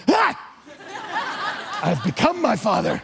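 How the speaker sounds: surprised gasp breaking into a laugh; mock shock dissolving into laughter; startled laughing realization. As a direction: laugh through the discovery that you turned into your dad. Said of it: "and I was like".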